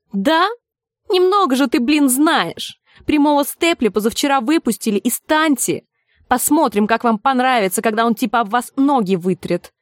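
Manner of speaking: put-on needling, mouthy young fan; cocky street youth. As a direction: trade slangy football jibes with a rival. Same youth taunting back.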